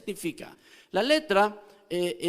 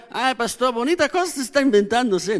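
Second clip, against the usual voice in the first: higher, skeptical churchgoer teasing the pastor; mocking churchgoer; 4 semitones higher than usual. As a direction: mimic a doubtful listener scoffing. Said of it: "No digan".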